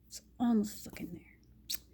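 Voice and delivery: whispered under her breath, exasperated; frustrated whisper. Retold or imitated. Muttering to herself in bed, a frustrated whisper. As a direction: hiss it to yourself in frustration. Inner voice, frustrated during sex.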